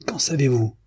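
high, irritated challenge; irritated growl. A narrator's reading. Demian tortured by her insight, irritated. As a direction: snap back, nettled. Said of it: "gronda celui-ci, irrité jusqu'à la torture".

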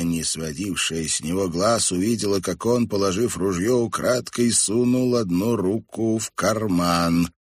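usual speaking voice

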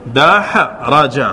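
دحرج stretched very slowly, each syllable separate; teacher repeating دحرج at a crawl for students; slowed teaching demo. Shown as a demonstration. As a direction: draw دحرج out slowly so every vowel is heard. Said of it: "انظر".

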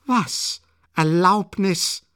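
high, loud, outraged echo; indignant cry. A narrator's reading. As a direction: throw the word back in outrage. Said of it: "schrie Katharina Iwanowna empört". (empörter Aufschrei, hoch und gedehnt)

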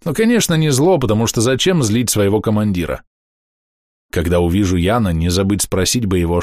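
plain reading voice

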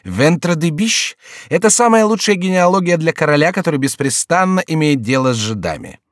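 high, mocking court fool; jester declaiming. A narrator's reading. Jester praising the king's mock genealogy, high declamation. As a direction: swear grandly, then explain with relish.

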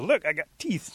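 high, loud showing-off; eager little animal. Acted. Little animal proves itself to the others, eager. Creature shows proof to animals.

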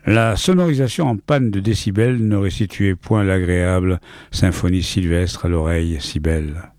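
plain reading voice

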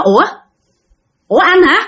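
startled. Retold or imitated. Helper seeing the man who should be showering, startled. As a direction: react in shocked confusion, retold. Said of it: "Thì lúc đó bạn hết hồn".

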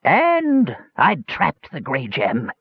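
high, drawn-out wizened shriek; shrieking old man. A narrator's reading. Wizened old mage gloating over the trapped gem, shrieking. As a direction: stretch it out in crazed triumph. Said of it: "shrieked an old wizened man with a wild cackle".

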